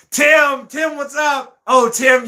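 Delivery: high, calling-out hello; delighted high-pitched shout-out; excited greeting. Real, not acted. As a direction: hail a friend who just arrived. Greeting viewer who just arrived.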